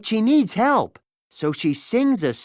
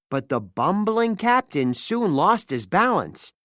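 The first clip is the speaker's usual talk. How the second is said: plain reading voice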